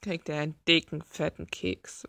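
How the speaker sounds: usual speaking voice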